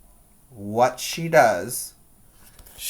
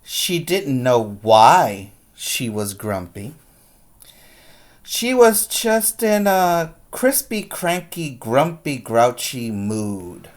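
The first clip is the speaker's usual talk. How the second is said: put-on lively read-aloud to kids; picture-book reading voice; story narration; 4 dB louder than usual. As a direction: read it like storytime, big and expressive.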